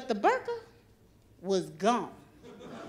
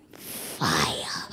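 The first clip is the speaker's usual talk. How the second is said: hushed whisper; 5 dB quieter than usual. Retold or imitated. Husband's punchline, hushed.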